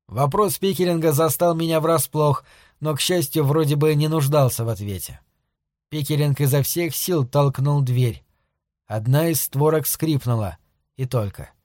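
ordinary delivery, nothing marked

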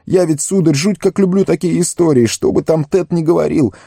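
high, breathless-with-nerves confession; trembling gardener. A narrator's reading. Sam confesses what he overheard, high and nervous. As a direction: babble it out high and anxious. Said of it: "начал Сэм всё ещё дрожащим голосом".

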